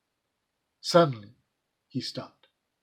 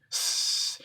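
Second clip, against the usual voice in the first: ordinary delivery, nothing marked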